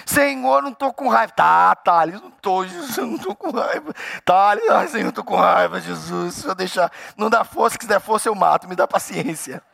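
hammed-up squabble, swinging in pitch and volume; comic pleading denial, breaking up into fragments; quarrelling with God. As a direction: protest to God like a cornered child. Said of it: "Você já brigou assim com Deus?".

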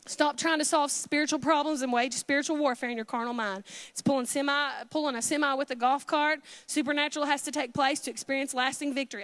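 ordinary talk

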